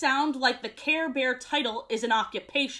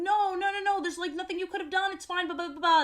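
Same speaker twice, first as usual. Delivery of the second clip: higher, mock coddling voice; babying reassurance; 6 semitones higher, faster than usual. Care Bears fussing over crying Paul, mock coddling. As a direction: coo it like soothing a toddler. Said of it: "They're babying him".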